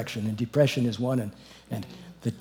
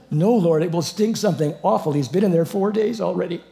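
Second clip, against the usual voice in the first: high, loud objection; hammed-up, frank complaint; blunt protest; 5 semitones higher, 5 dB louder than usual. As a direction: protest loudly as if appalled by the stink. Acts Martha protesting at the tomb.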